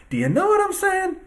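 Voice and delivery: sarcastic high-pitched repeat of the question; mock high squeak. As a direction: jump way up high on know what I'm saying. Repeats rhetorical question mockingly.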